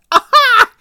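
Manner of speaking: high, loud taunting aha; mocking cry. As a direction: crow over the prince. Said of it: "she cried mockingly".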